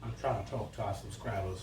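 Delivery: talking through a mouthful; words round a bite of food; mouth full. Real, not acted. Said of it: "don't teach with your mouth full".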